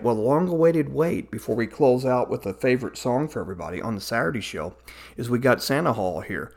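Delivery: usual speaking voice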